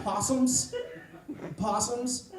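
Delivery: high-pitched disbelieving question; incredulous. Acted. Incredulous reaction to retold warning.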